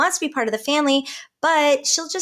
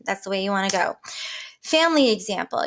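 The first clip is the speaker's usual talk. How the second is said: ordinary talk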